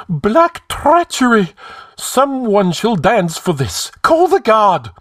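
high, loud cry of outrage; slow, high-pitched alarm, shouted out; exclaiming. A narrator's reading. High Counselor calling for the guard over treachery, exclaiming. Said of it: "exclaimed Publius, the High Counselor".

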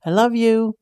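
calling out after him. A narrator's reading. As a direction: call it across the room. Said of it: "she calls out".

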